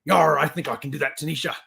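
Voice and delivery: lightly growled pirate yar; hammed-up pirate accent; pirate talk. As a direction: play a pirate for fun. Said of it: "National Talk Like a Pirate Day".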